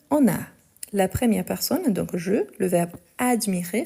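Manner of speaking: ordinary talk, nothing marked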